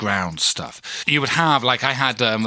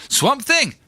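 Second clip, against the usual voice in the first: put-on dodgy South African accent, pitched high; bad South African accent; 14 semitones higher than usual. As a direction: try and fail at South African. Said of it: "I can't do the accent".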